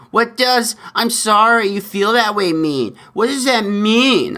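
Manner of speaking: mocking heckler. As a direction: stretch out the question in a mocking high whine. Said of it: "a male in the background yells".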